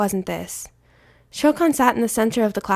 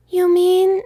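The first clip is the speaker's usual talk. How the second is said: put-on high, eager child voice; a small boy hoping it is true; lightly voiced eager kid; young boy; 10 semitones higher, 6 dB louder than usual. A narrator's reading. Son's eager hopeful question.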